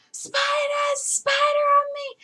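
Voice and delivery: high, loud shrieking panic; panicked scream. Retold or imitated. Girl spots a spider on her arm, high panicked shriek. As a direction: shriek it like it's crawling on you. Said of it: "and I'm like".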